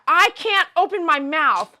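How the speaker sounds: loud, high, slowed-down complaint; acted-out protest. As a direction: shout each word slowly at the dentist. Acts out protest to dentist.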